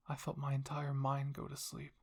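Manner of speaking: ordinary delivery, nothing marked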